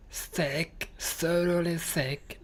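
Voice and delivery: slow, drawn-out put-on British accent; thick British accent. Shown as a demonstration. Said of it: "Not sure about it being British".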